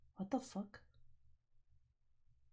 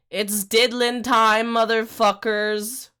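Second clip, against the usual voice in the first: hype catchphrase; 9 dB louder, slower than usual. Pretend. Hyped stream catchphrase.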